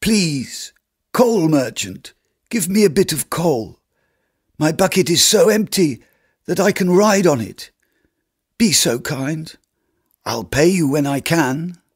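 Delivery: high, pleading cold voice; a frozen beggar begging the coal merchant. Acted. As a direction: beg up at him, frozen through. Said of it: "in a voice burned hollow by the cold".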